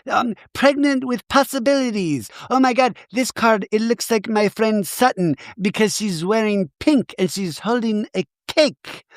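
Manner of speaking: high, breathy-free squeaky mock Jennifer; high mock Jennifer Tilly gushing over a tarot card. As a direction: gush like a ditzy star.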